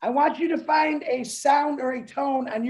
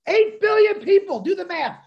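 excited shout; 7 semitones higher than usual. Real, not acted. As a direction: punch the number out with excitement. Hyping the number again.

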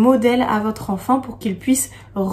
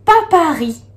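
"papaté" called out higher and louder; cheerful louder "papaté"; loud model answer; 6 semitones higher, 5 dB louder than usual. Shown as a demonstration. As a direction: announce "papaté" triumphantly. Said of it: "le mot Paris".

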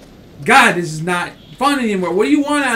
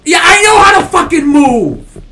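screamed high and loud at the game; angry yell at the game; furious shout; 9 semitones higher, 12 dB louder than usual. Real, not acted. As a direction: yell it in real anger. Yelling back at the game.

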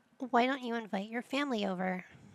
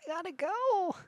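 urgent need to go; 10 semitones higher, 3 dB louder than usual. Retold or imitated. Kai needing the bathroom during toasts, mock-desperate high voice. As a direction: imitate someone bursting for the bathroom. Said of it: "and he's like".

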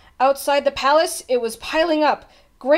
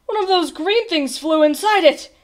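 raised, louder cry of dismay; 7 semitones higher, 5 dB louder than usual. A narrator's reading. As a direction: complain in startled distress. Trumpeter dismayed at clogged horn.

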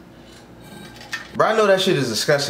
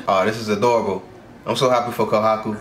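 ordinary delivery

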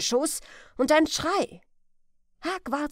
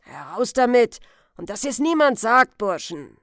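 loud, forceful order; commanding old man; 10 dB louder than usual. Acted. Old man ordering Huck and his sons, loud command. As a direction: command them to speak and keep silent.

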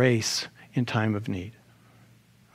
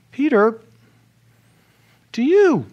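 high, louder call with held words; 10 semitones higher, 5 dB louder than usual. Acted. Jesus questioning Peter on his special trip, calling out high. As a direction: call him by name, then press the question.

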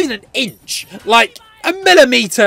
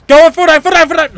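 high, loud repeated urging; urgent egging on; 6 semitones higher, 4 dB louder, faster than usual. Real, not acted. Player willing an enemy to fall, urgent shout. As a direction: egg him on in a raised voice.

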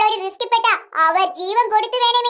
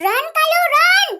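higher, louder cry to run; urgent shout; 4 semitones higher, 4 dB louder, much slower than usual. Acted. Warning her friend someone is coming, shouting. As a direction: yell at her friend to flee.

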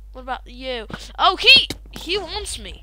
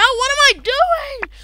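high, loud panicked wail; panicked cry; 10 semitones higher, 6 dB louder than usual. Real, not acted. Caught off guard in the game, high panicked cry. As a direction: cry it out in alarm.

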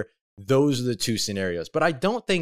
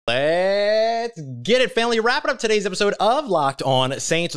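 rally the family, stretched out; hype shout; 9 semitones higher, 4 dB louder than usual. Real, not acted. Hype sign-off to listeners.

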